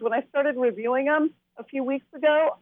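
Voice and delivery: ordinary talk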